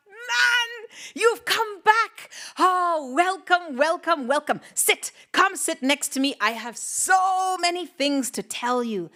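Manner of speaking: excited welcome. Acted. Fire greets returning man.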